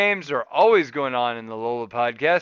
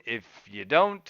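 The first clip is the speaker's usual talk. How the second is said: ordinary delivery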